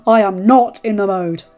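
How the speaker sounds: angry husband. Acted. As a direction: warn her off, barely holding back. Husband angrily confronts his wife.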